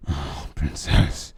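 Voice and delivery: slow, breathy, almost whispered; slow airy murmur to his partner; breathy moan. A narrator's reading. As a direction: breathe the words out slowly. Lover moaning during sex.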